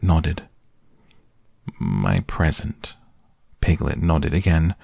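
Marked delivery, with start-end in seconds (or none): 1.8-2.9 low, slow and dejected; gloomy flat. A narrator's reading. Eeyore holds Piglet's rag, gloomy and slow. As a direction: let it sink in heavily.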